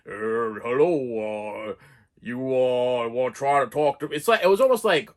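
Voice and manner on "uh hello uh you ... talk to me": masked killer's voice. Retold or imitated. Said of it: "he has his voice, and it's like".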